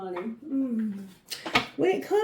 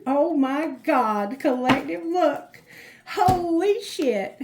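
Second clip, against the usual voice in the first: high, loud and slow amazement; shocked; 4 semitones higher, 5 dB louder, slower than usual. Real, not acted. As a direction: stretch it out in real disbelief. Shocked by cards pulled.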